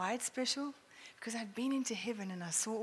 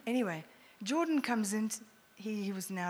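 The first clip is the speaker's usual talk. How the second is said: ordinary delivery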